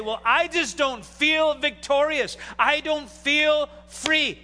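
slow, drawn-out imitation of a complaining churchgoer; mock whiny believer. Pastor mimicking a doubting churchgoer, slow and put-on. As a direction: play the doubter, dragging the words. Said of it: "you say".

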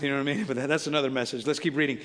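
ordinary delivery

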